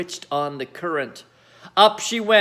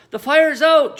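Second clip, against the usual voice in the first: proudly; 9 semitones higher, 6 dB louder than usual. A narrator's reading. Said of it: "George announced proudly".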